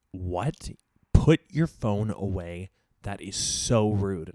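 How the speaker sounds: loud, slow indignant order; mock scolding date. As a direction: bark it like an offended date. Date scolding her about phone.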